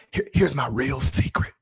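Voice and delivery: secret revealed out loud. Pretend. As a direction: finally say the secret aloud. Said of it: "come here, lean in".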